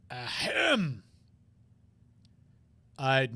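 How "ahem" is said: theatrical ahem; throat clearing. Acted. Character clears his throat.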